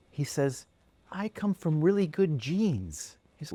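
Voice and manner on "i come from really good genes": put-on higher, slow childish voice; sweet little boy. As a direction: boast sweetly like a tiny kid. Little boy character speaking.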